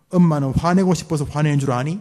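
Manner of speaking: a mother justifying her anger; scolding mother. Shown as a demonstration. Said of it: "한번 들어갑시다". (변명하며 화내는 엄마)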